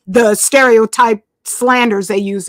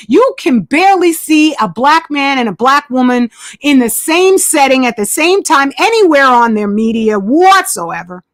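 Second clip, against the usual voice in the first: ordinary talk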